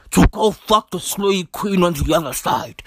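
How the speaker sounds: low put-on politician's voice, self-important; Gwede Mantashe. Comedian as minister boasting he is unique, deep mimicking voice. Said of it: "He talks like that".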